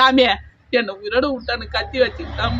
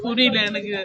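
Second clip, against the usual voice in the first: normal talking voice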